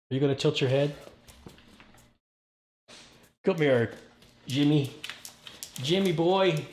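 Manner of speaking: high, loud sing-song calling to the dog; excited dog talk. Real, not acted. Owner calling his dog Jimmy over, high and loud.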